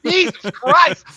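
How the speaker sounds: high, loud, slow exclamation; drawn-out exasperated yell; exasperated outcry. Retold or imitated. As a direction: cry it out in mock despair. Retelling exasperated reaction, laughing.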